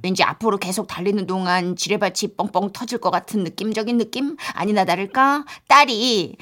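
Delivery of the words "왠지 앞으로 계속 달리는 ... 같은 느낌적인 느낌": ordinary delivery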